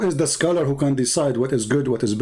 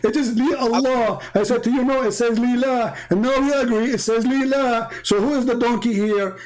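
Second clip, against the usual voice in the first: high, heated scolding; angry tirade; 6 semitones higher than usual. Real, not acted. Debating a caller, he scolds the opponent angrily. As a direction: rail at him, pitch way up.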